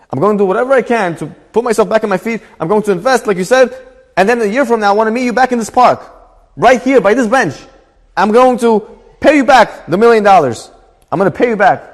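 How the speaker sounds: high, emphatic acted promise; earnest excited vow. Acted grateful man vowing repayment.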